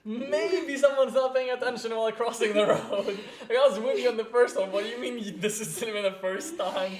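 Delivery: high, loud, incredulous questions through laughter; laughing disbelief. Real, not acted. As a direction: crack up while questioning a friend in disbelief. Laughing disbelief at repeated car accidents.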